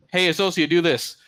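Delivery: high, loud mock command; imitated manager calling across the floor; calling out an order. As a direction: order a coworker over to take the job. Acted ordering a worker around.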